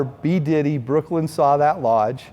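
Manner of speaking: ordinary talk, nothing marked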